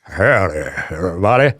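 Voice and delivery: low, put-on Southern country DJ voice; country DJ accent. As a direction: imitate a legit country DJ twang, low. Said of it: "country radio D J ... with his accent".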